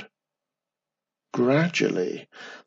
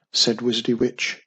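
plain reading voice

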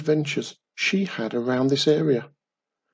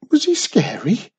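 higher, louder anxious little voice; worried child; 10 semitones higher, 5 dB louder than usual. Acted. Wiz asks Mum about goblin, worried. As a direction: ask it nervously, like a small girl. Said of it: "worried Wiz".

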